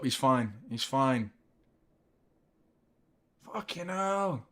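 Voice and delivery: ordinary delivery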